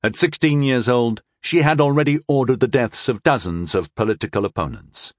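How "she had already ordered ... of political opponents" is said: plain reading voice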